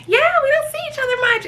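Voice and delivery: high, sing-song fake friendliness; mock fake-friendly chat. To Megan over drinks, high sing-song friendliness. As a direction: put on a bubbly party voice.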